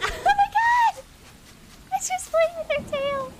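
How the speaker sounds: high squeal of delight; gushing delight. Real, not acted. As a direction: squeal over something adorable. Cat playing with its tail.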